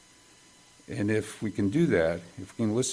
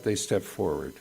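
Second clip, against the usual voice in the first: usual speaking voice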